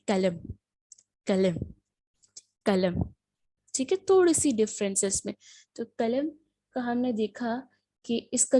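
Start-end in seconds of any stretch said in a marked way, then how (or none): 0.1-3.0 قلم repeated slowly, each said the Turkish way, lower; Turkish pronunciation repeated. Shown as a demonstration. Teacher drills students on Turkish قلم, careful repeats. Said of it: "تو یہ کیا ہے؟".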